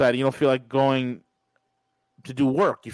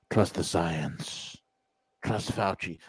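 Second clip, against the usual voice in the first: sarcastic, low and slow slogan; 11 semitones lower, 7 dB quieter, slower than usual. Real, not acted. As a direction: sneer the slogan in a deep drawl.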